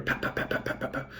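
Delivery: soft unvoiced pops fading away; whispered pop pop as heads surface; imitating heads popping up all around; popping sounds.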